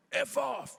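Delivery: huffy. Retold or imitated. Doorman turning away persistent young musicians, huffy. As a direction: shout it as an imitated huffy brush-off. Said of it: "he had a real huffy attitude".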